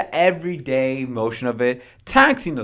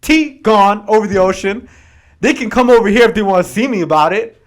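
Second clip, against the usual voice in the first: loud, defiant outburst, faster at the end; defiant colonists; 5 semitones higher, 8 dB louder than usual. Retold or imitated. Colonists tossing tea, daring Britain: loud defiant retort. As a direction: bark it out like fed-up rebels. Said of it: "and they're like".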